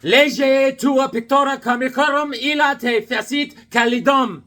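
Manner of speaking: loud, high, slow mock-solemn proclamation; mock Latin recital. Shown as a demonstration. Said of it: "This is how it is. It goes".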